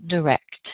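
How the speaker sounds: "direct" said cleanly with stress on rect; model pronunciation. Shown as a demonstration. Teacher modelling "direct" for learners, clear and careful. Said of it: "how it sounds when you say".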